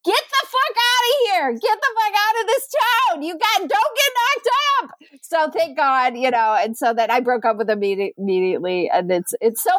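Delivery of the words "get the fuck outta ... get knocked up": high, mock-urgent mom warning; a mother telling her daughter to get out of town, pitched way up; mom's real message. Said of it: "it totally was like".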